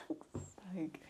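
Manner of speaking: unvoiced exasperated whisper; whispered exasperation. Real, not acted. Fed up with the hug scene, whispered curse. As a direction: breathe it out fed up.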